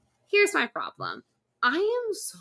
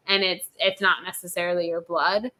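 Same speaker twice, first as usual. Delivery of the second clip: ordinary delivery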